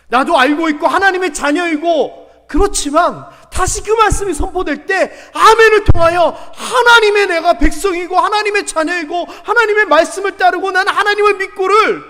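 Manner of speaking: loud, high, unbroken preaching surge; impassioned preaching. Real, not acted. Preacher urging reaffirmation as God's people, impassioned and loud. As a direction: pile up the declarations at full force.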